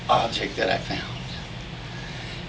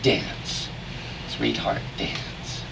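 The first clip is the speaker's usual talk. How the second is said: whispering; 3 semitones lower, 6 dB quieter, much slower than usual. Acted. Hushed refrain in poem.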